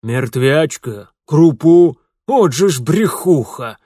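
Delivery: loud, scornful constable; scornful bass. Acted. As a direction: spit out the insult.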